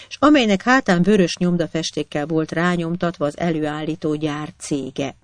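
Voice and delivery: usual speaking voice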